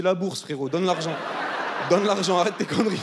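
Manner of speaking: comic outburst at an interviewer; blunt demand. Acted. Blurted demand in retold interview.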